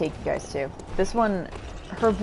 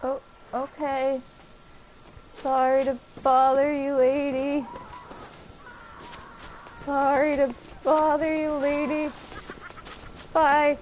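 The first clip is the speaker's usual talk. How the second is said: high mock politeness; 6 semitones higher than usual. Player talking to the game's old lady in a high mock-polite voice. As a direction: raise the voice into a thin, high, overly polite apology.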